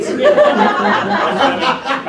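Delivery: stretched, loud and high through laughter; laughing. Real, not acted. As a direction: laugh the word out. Laughing at own pun.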